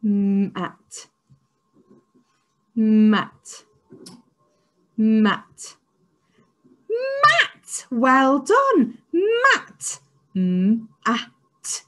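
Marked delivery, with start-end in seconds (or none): 2.7-5.8 Mat said firmly and louder; blended word. Shown as a demonstration. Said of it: "start with them separately". 6.9-9.0 Mat squealed much higher and louder; delighted high squeal; excited squeal. Shown as a demonstration. Teacher celebrates blended word. 9.1-10.0 Mat squealed much higher and louder; delighted high squeal; excited squeal. Shown as a demonstration. Teacher celebrates blended word.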